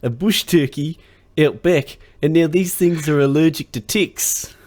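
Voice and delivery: high, loud mock New Zealand storyteller; Kev impression. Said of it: "I'm gonna do his intro".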